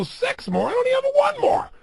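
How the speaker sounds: high, loud, strained disbelief; exhausted protest. Pretend. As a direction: cry out like you're spent at the gym. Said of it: "You go".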